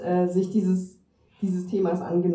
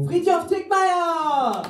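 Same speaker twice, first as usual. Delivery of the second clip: high, loud, stretched name; announcer shout; 11 semitones higher, 8 dB louder, slower than usual. Real, not acted. Host hails the reader to the audience, announcer shout. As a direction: belt out the name for applause.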